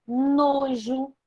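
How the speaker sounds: ordinary talk, nothing marked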